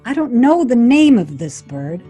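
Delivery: usual speaking voice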